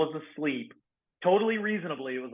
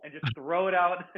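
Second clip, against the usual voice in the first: ordinary talk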